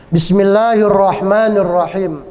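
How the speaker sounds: measured, projected recitation with long vowels; Qur'anic recitation. Shown as a demonstration. Recites the surah.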